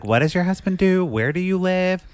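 high, probing questions; lightly acted wary interrogation; mildly suspicious questioning. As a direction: imitate someone grilling a stranger. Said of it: "She's suspicious about this too".

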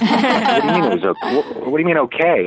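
high, laughing mock outrage; laughing indignation. Retelling the reply to his friend's lukewarm verdict, in laughing mock outrage.

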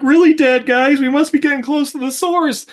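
squeaky high-pitched goofy voice; high mock voice. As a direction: jump way up into a silly falsetto. Character voice, high pitched quote.

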